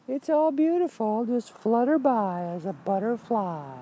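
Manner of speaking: mock a blissed-out seeker fluttering along; airy butterfly dreamer. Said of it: "trying to go".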